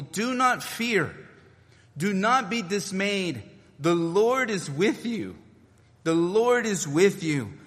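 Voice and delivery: slow, high, earnest reassurance; preacher voicing Jesus to fearful people, slow and earnest; emphatic preaching. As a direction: plead the promise slowly, each word weighed. Jesus' words voiced emphatically.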